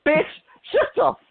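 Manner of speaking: sudden high-pitched "shut up"; high yell. Real, not acted. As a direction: shriek it at someone. Shouting down a mock quote.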